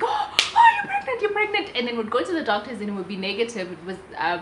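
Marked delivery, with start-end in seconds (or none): 0.0-1.7 high, loud gushing excitement; husband thinking the test is positive, overjoyed; excited husband. Retold or imitated. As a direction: imitate him bursting with joy. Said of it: "would be excited".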